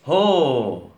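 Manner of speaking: loud booming call to reindeer. Acted. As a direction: shout to start the sleigh. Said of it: "rief der Weihnachtsmann".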